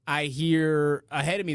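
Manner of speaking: ordinary talk, nothing marked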